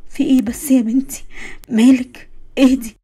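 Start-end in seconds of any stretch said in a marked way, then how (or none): none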